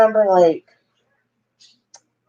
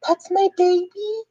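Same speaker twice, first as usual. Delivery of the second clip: high, slow wondering question; retold amazed question. Mother to anesthesiologist on hearing her baby, imitated amazed high question. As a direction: ask it slowly, pitched up in wonder.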